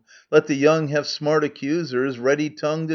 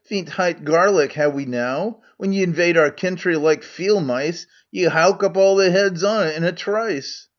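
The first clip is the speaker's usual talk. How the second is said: Scots Megarian; 3 semitones higher than usual. Acted. Megarian role in Scots dialect.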